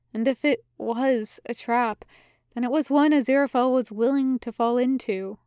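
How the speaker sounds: ordinary delivery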